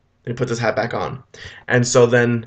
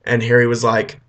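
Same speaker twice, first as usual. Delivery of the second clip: ordinary delivery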